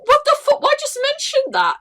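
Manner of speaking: shocked high-pitched outrage; incredulous squeal. Real, not acted. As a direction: screech it in disbelief. Incredulous outburst.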